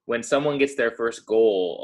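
usual speaking voice

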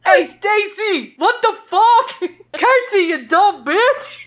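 high, loud, stretched-out scolding; hammed-up frantic calling through giggles; mock urgent prompting. Acts her prompting a friend to curtsy, mock frantic.

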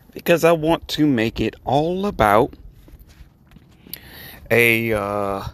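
normal talking voice

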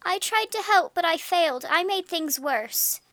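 put-on distraught girl crying out. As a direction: wail it in guilt. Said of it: "I cried".

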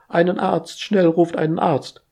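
high, loud call for help; schreit um Hilfe. Acted. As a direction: yell for a doctor in panic. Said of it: "Katalin Bucher schreit". (panischer Hilferuf)

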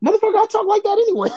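high-pitched, fast outburst; indignant protest. Real, not acted. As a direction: protest in a rush, voice shooting way up. Defends himself against teasing about voice.

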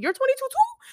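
very high incredulous question; imitated astonishment; acted disbelief. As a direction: squeak it out in mock surprise. Retold shock at officer's age.

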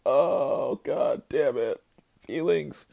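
high, wailing fake sob; hammed-up baby blubbering; mock blubbering. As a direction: ham up crying like a baby over a show ending. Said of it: "blubbering like a goddamn baby".

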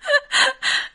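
high giggle; laughing. Real, not acted. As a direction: breaks into giggles. Laughing along. (咯咯笑)

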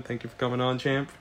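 ordinary talk, nothing marked

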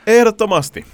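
loud, high, emphatic answer; emphatic yes. Real, not acted. Defending pineapple on pizza to a friend, emphatic.

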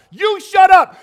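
loud, high shout back; shouted retort. Acted. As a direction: yell back at the critic. Beggar retorts.

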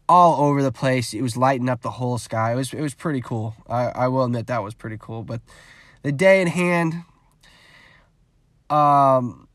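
ordinary delivery

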